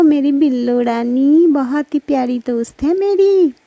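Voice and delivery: a hint of a fond magic buffalo; kind buffalo friend. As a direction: sweet-talk a dear friend. Magic buffalo Billo speaks kindly.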